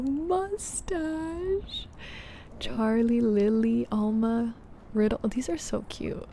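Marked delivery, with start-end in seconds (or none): 0.0-1.9 Mustache said much higher, rising at the end. Shown as a demonstration. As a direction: lift Mustache up high with a rising tune.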